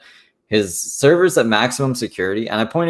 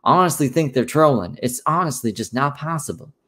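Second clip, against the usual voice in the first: higher-pitched impression of someone denying it; mock impression pitched up; Revzilla voice; 6 semitones higher than usual. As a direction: put on a higher, mimicking voice. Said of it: "or do my Revzilla voice".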